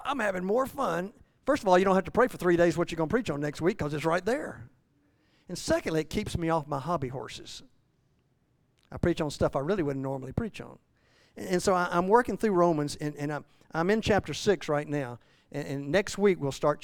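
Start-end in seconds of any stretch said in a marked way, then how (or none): none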